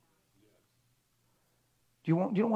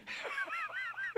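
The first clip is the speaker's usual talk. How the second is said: sudden high laugh at the joke; high burst of laughter; 9 dB quieter than usual. Real, not acted. Laughing at own impression.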